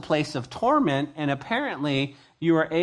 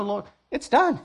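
higher, happy believer arriving in heaven; lightly voiced delighted believer; 8 semitones higher than usual. Believer arriving in heaven, speaking to the Lord, lightly delighted. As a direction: sound amazed to be home. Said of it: "You go".

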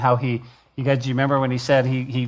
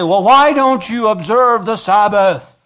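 loud, high challenging voice; a hammed-up challenger; mocking objector; 8 semitones higher, 7 dB louder than usual. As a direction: play the nagging critic. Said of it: "people come in here and say".

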